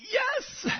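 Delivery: tiny high-pitched squeak. Acted. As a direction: squeak it out nervously. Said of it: "and squeaked out".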